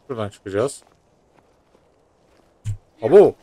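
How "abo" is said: sudden loud startled yelp; startled shout. Real, not acted. Gamer caught off guard by a monster, shouting. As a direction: jump at the shock and shout it out.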